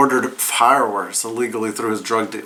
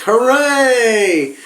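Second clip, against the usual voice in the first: high mock cheer; 11 semitones higher, 4 dB louder than usual. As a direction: shout hooray like a crowd. Police cheering, voiced.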